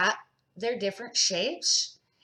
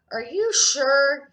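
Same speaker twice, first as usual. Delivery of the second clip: slow, louder doubtful challenge; skeptical; 5 semitones higher, 7 dB louder, much slower than usual. A narrator's reading. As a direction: drag it out in disbelief. Boy folding arms, furrowing brow.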